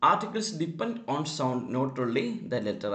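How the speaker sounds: slow, emphatic reminder; slow emphatic rule. Shown as a demonstration. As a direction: drive the rule home slowly. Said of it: "ഒരിക്കൽ കൂടി ഓർമ്മിപ്പിക്കുകയാണ്".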